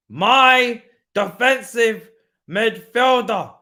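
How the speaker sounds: very slow, loud, lingering on every syllable; milk it like a proud fan. Acted. Repeats praise even slower.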